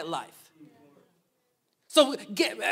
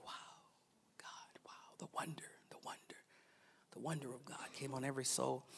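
breathless, hushed wonder; awed whisper; 7 semitones lower, 21 dB quieter than usual. Retold or imitated. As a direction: whisper as if awestruck. Awestruck whisper of believers.

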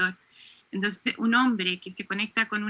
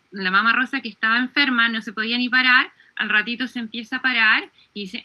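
ordinary delivery

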